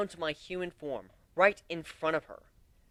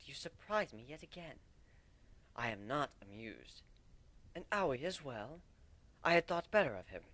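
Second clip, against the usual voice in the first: a lightly voiced royal letter, cool and stern; Princess Celestia's letter; 9 dB quieter than usual. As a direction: read it as a disapproving princess, mostly soft.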